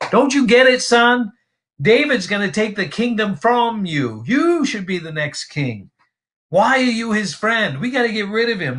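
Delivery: voicing Saul. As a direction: press your son hard, like Saul. Preacher voicing Saul to Jonathan.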